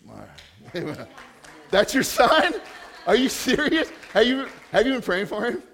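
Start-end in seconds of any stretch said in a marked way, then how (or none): 1.7-5.6 loud, high, laughing disbelief; delighted incredulity at an unexpected coincidence; real amazement. Real, not acted. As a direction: fire astonished questions, voice jumping up. Learns man is her son.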